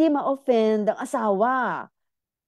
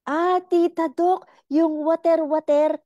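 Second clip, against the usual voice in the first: high, louder excited viewer; surprised viewer voice; 9 semitones higher, 4 dB louder than usual. Retold or imitated. Viewer exclaiming to Tita Doc about lubrication, high and excited. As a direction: squeal it like an eager fan.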